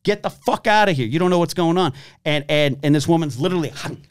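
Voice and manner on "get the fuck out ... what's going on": higher, louder barked command at bystanders; security guard yelling at filming bystanders; retold yell. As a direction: shove them back with the voice. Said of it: "I literally was like".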